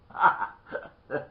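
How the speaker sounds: loud, breathy burst of laughter; punchline laugh. Real, not acted. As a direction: laugh at your own tale's punchline. Teller laughs at own punchline.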